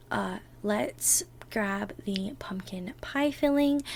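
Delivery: slow, soft whisper. Acted. Partner starting the baking together, slow soft whisper. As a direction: whisper gently as if not to wake anyone.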